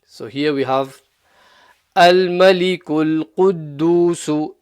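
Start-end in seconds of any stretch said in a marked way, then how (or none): 1.9-4.5 AL-MALIKUL QUDDUSU slow, with a clear back qaf. Shown as a demonstration. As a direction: recite AL-MALIKUL QUDDUSU louder, qaf distinct, vowels long. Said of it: "the qaf needs to be clear".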